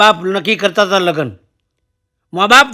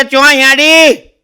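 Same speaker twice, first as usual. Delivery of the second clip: uncle's slow query; 5 semitones higher, 5 dB louder, much slower than usual. Retold or imitated. Uncle questions in village dialect.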